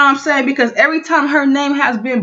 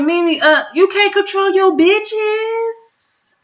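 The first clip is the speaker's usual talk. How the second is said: high, slow taunt; mocking; 7 semitones higher, slower than usual. Real, not acted. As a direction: taunt her, drawn out. Mocking Mimi.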